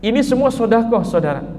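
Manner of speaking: normal talking voice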